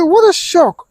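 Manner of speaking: high exclamation of dismay; shocked. Retold or imitated. Host reads a viewer's shock at the death, stunned.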